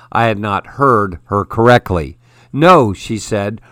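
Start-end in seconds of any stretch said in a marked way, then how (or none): 2.5-2.9 a firm, loud No. A narrator's reading. Freya to Johannes, firmly refusing his mishearing. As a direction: shut the mistake down at once. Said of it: "her voice firm".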